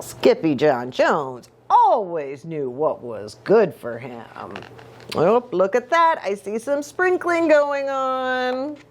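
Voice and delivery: ordinary delivery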